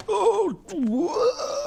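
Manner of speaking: sudden very high, loud yelp; startled oh. Real, not acted. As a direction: jump at noticing the shirt. Startled by noticing his shirt.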